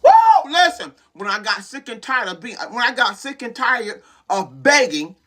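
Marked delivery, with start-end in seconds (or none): 0.0-0.5 very high, loud "woo"; piercing burst of fervour; whoop. Real, not acted. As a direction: let out a sudden preacher's whoop. Exclaims while preaching.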